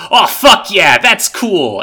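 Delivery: loud, delighted outburst; excited. Retold or imitated. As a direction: cheer it with a laugh. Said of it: "make you go".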